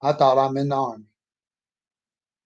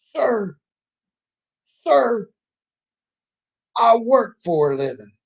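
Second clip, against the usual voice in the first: angry drill sergeant; 9 semitones higher, 4 dB louder, slower than usual. Retold or imitated. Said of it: "the angriest man I'd ever met".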